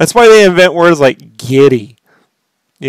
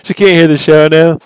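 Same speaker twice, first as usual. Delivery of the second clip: ordinary talk, nothing marked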